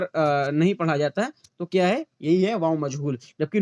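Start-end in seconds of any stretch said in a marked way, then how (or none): none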